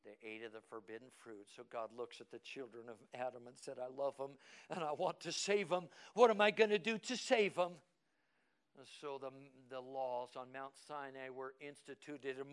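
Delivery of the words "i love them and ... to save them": God's urgent question, preached with rising force; impassioned preaching. Acted. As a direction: build to a loud, high plea.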